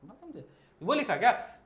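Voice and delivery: usual speaking voice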